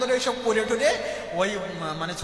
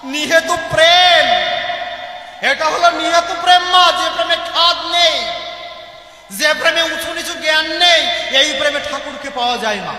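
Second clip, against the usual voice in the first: fervent preaching; 3 semitones higher, 7 dB louder than usual. Acted. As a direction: proclaim it to the whole gathering. Preaches on selfless love. (ভাবাবেগে উচ্চস্বরে প্রচার)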